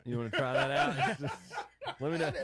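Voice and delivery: giggling through the question; laughing while teasing. Real, not acted. Teasing about chocolate wings.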